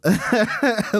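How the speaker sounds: short amused laugh; chuckle. Real, not acted. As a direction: laugh briefly at your own remark. Laughs at own remark.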